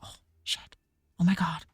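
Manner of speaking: whispered mock "oh shit" of a caught celebrity. Mocking celebrities caught out over their AI-trained music, whispered. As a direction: hiss it like someone caught with a hand in the cookie jar.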